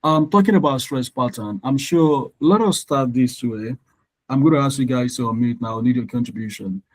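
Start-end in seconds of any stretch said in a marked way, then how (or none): none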